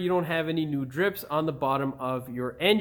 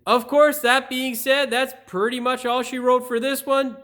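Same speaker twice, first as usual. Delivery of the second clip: bright, loud presenter voice, higher; upbeat host read; 7 semitones higher, 6 dB louder than usual. Acted. Channel host pitching subscribe to viewers, upbeat presenter read.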